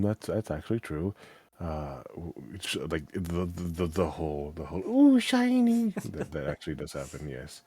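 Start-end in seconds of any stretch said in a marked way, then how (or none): 4.9-5.9 high, slow, starry-eyed coo; dazzled dragon. Retold or imitated. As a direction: gasp at something shiny. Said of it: "the whole thing about dragon syndrome".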